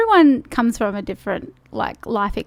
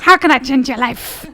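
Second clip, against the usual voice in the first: a lightly done bad Scottish accent, louder; terrible Scottish accent; 6 dB louder than usual. Host jokingly voices the guest as a Scottish life coach in a bad Scottish accent. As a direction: have a go at a Scottish accent, badly. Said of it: "That was my terrible Scottish accent".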